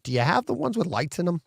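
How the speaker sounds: eager question. Retold or imitated. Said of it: "I'm like".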